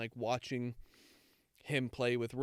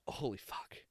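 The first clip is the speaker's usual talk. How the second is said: breathy unvoiced whisper of shock; whispered awe; 8 dB quieter than usual. Recalling her reaction to the show, whispered holy fuck. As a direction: whisper it like the scene just gutted you.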